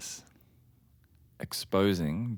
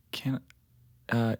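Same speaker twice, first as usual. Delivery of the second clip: ordinary talk, nothing marked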